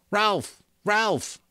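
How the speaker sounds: high, loud urgent calls; calling out. A narrator's reading. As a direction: call out the name in alarm. Urgent call in the dark.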